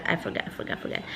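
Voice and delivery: breathy whisper of I forgot; whispered frustration. Real, not acted. Muttering to herself over the lost edit, whispered.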